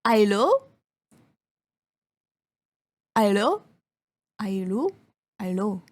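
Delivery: repeated Allô, trailing off; French hello. Shown as a demonstration. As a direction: call out Allô like answering a French phone. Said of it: "I could say".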